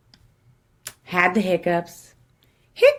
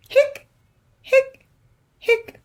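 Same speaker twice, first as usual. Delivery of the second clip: high squeaky hic hic hic; squeaky hiccups; slower than usual. Acted. Skeleton hiccuping to the kids, high squeaky hics.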